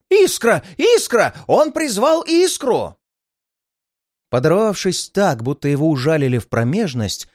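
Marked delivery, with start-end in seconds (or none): 0.1-0.6 panicked shout. A narrator's reading. Healer repeats in panic. 0.8-1.3 panicked shout. A narrator's reading. Healer repeats in panic. 1.5-3.0 high, loud panicked cries; panicked shouting. A narrator's reading. Healer panics at the spark.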